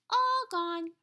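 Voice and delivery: put-on high, loud toddler voice; a proud little boy showing his missing tooth; little kid voice. A narrator's reading. Little Fudge to his brother, high and proud toddler voice.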